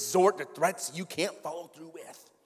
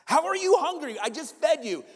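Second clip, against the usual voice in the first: perplexed parent; 8 semitones higher than usual. Acted. Parent to a just-fed kid claiming hunger, perplexed. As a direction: ask it in exasperated disbelief. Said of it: "you're just perplexed".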